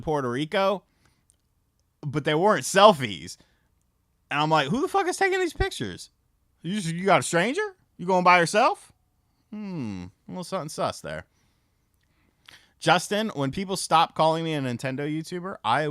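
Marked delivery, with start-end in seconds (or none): 4.7-11.3 suspicious. Retold or imitated. Suspicious about the girl's photos.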